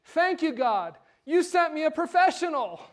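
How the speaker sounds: loud, high-pitched acted thanks to God; imitating an overjoyed woman crying out; joyful proclamation. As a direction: proclaim it to heaven as the punchline. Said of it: "then she proclaims".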